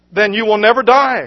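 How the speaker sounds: loud, high, sharp retort; snapped. Acted. Head nurse snaps at praying Edith, angry retort. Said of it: "snapped Phyllis".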